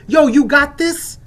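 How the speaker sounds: loud, slow, demanding call into a store; brash shout. Retold or imitated. Recalling storming stores, a loud slow demand. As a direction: bust in hollering for carbs. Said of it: "bust in and say".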